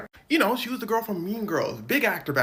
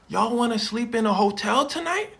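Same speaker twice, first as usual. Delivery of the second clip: loud coaxing question; 5 semitones higher, 6 dB louder than usual. Retold or imitated. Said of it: "She says".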